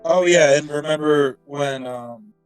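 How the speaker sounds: ordinary talk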